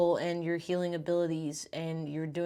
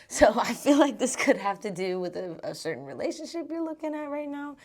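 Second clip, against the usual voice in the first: laughing; 7 semitones higher, 6 dB louder than usual. Real, not acted. Laughs while reading cards.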